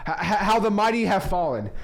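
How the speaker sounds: mock tragedy over watching reality TV; mock dramatic lament. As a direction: declaim it like a fallen hero. Mock lament about Love Island.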